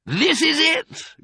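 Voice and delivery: high, slow, triumphant announcement; excited cry. A narrator's reading. Mister Fox finds the cellar, excited cry. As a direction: cry it out, stretching each word. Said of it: "cried Mister Fox".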